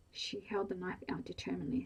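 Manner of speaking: ordinary delivery, nothing marked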